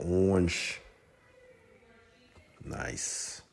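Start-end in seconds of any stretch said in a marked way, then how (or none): 2.6-3.4 whispered nice. Real, not acted. Admiring shoe detail.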